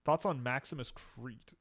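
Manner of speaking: ordinary talk, nothing marked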